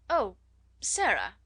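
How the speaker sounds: startled exclamation with a falling tune. A narrator's reading. Headmistress coming upon a pupil, exclaiming. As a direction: exclaim the name, caught out. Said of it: "she exclaimed".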